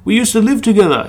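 insist, mock pleading; old mate reminding Danny they lived together; old Chinese man voice. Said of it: "He's like".

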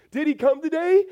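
high, rising, worried question; a hint of an anxious child; mock anxious question. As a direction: ask it like a nervous kid. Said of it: "we're not gonna be asking".

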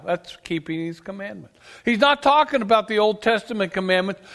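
ordinary talk, nothing marked

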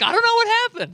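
very high, loud squeaky innocence; childish Aaron. Retold or imitated. Aaron pleading innocence, squeaky childlike voice. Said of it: "sounds like Aaron's a five-year-old".

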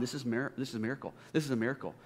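soft, awed murmur to himself; hushed inner thought. Retold or imitated. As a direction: say it quietly as if thinking aloud. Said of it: "I'm just thinking in my mind".

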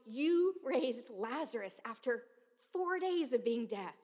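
slow, loud desperate plea; grieving father shouting at God; retold shout. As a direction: drag it out, begging God aloud. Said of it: "I shouted".

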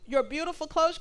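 ordinary delivery

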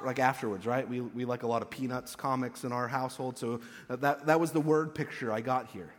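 usual speaking voice

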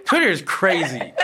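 said again with a laugh. Shown as a demonstration. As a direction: repeat it, chuckling. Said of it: "Trill, say that again".

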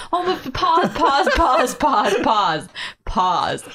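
high, loud, rapid repeated halt, laughing; excited interruption. Real, not acted. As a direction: burst in, then gradually come down. Halting the talk over the slip.

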